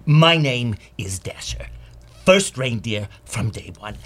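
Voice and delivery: measured, self-important veteran reindeer; solemn middle-management warrior, deliberately paced. Acted. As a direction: announce yourself like a blindly committed old campaigner. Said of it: "he might, uh, say something like this".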